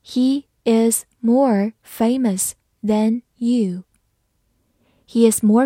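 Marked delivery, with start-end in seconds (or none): 0.0-3.8 slow teacher's model with a gap after each word; slow reading. Shown as a demonstration. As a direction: read it slowly, word by word. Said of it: "我们把这个句子读一遍". (慢速逐词朗读)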